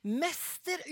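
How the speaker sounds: she recognises him and bursts out; exclaiming. A narrator's reading. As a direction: Mester rising from a whisper into a joyful cry. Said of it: "utbryter hun".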